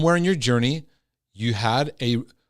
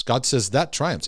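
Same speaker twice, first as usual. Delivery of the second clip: ordinary delivery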